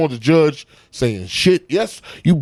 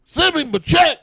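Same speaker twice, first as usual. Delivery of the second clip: much higher and a bit louder, gleeful hammed-up demand; mock demand again; 13 semitones higher, 4 dB louder than usual. Teller repeating the Cosby punchline to host, higher and gleeful. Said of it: "That's what I would say if I was him".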